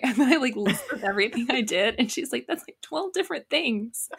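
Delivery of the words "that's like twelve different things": high-pitched retold disbelief; imitated incredulity. Imitating friend reacting to a busy day's list, incredulous.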